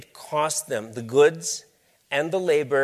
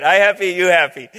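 cheerful echo; 5 semitones higher, 9 dB louder than usual. Retold or imitated. Speaker echoes dealer.

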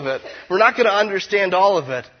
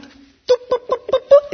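put-on high cooing boop noises to a baby; baby talk; 7 dB louder than usual. Shown as a demonstration. Preacher cooing at an imagined newborn in high baby talk. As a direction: coo way up high like to an infant. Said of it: "isn't that communication with an infant".

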